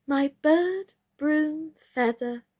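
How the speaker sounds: high, scattered listing; flustered doll. A narrator's reading. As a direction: dither between wishes. Birdie unsure which to wish.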